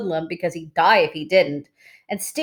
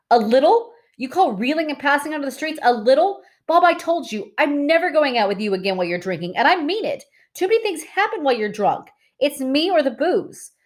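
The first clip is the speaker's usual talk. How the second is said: high, sharp angry rebuke; angry retort; 9 semitones higher than usual. A narrator's reading. Cherry scolding Bob about his drinking, high and angry. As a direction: put Bob in his place, furious. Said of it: "Cherry looked mad".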